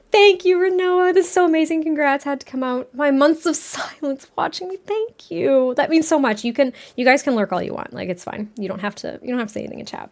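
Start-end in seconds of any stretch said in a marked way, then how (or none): 0.1-6.4 pitched up and touched, thanking a viewer; touched gratitude. Real, not acted. 7.9-10.1 much softer, easy and kind; hushed, kind permission to lurk; soft reassurance. Real, not acted. Reassuring lurkers.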